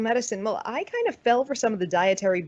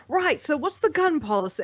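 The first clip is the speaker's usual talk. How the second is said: higher-pitched, bad British accent; butchered British accent; 7 semitones higher than usual. American quoting English people questioning her, mock British accent. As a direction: imitate a curious Brit, accent badly done. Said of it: "I'm butchering a British accent".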